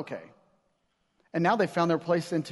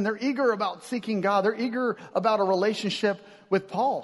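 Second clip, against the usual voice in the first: normal talking voice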